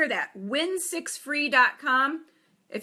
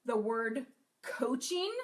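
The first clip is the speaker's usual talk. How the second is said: coaching drawn out slowly with a rising tune; slow, rising emphasis on coaching; stress on coaching; 2 semitones lower, 6 dB quieter, slower than usual. Shown as a demonstration. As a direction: lean slowly into the word coaching. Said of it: "I cannot emphasize enough".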